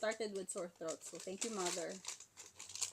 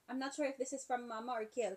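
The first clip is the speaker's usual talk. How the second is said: ordinary talk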